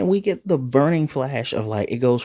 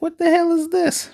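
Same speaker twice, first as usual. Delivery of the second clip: shocked. Retold or imitated. Kenpachi shocked at the brain in a jar, high stunned disbelief. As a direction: jump way higher, stunned disbelief.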